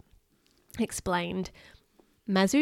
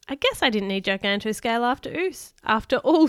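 usual speaking voice

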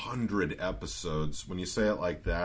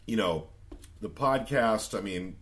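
normal talking voice

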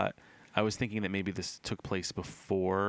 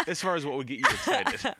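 laughing; 8 semitones higher, 3 dB louder than usual. Real, not acted. Laughing while speaking.

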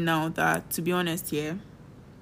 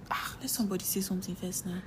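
whispered aside; 9 dB quieter than usual. Real, not acted. Playful protest, whispered aside.

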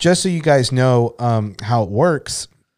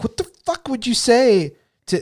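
incredulous; 11 semitones higher than usual. Retold or imitated. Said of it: "And I'm just like".

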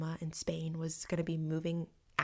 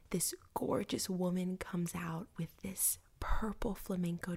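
whispered guided imagery; 4 dB quieter than usual. Acted. Host whispers to listener imagining Spain, hushed guided imagery.

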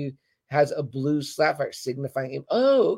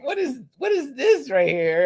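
much higher, slowed, incredulous; bewildered question; 8 semitones higher than usual. Retold or imitated. Fan staring at the screen, high and baffled. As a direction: ask it as if truly baffled. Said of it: "I was like".

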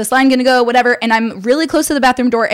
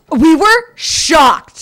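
shocked; 4 semitones higher, 3 dB louder, much slower than usual. Real, not acted. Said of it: "reacting to the surprise".

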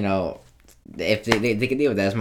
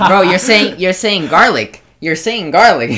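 exasperated outburst; 8 semitones higher, 10 dB louder, slower than usual. Retold or imitated. Mock outburst at a mumbled word.

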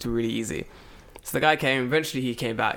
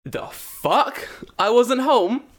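loud, high indignant outburst; retold outraged yell at the delivery email; 11 semitones higher, 7 dB louder, much slower than usual. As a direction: blurt it out in disbelief. Outraged at the failed delivery note.

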